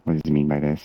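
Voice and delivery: ordinary talk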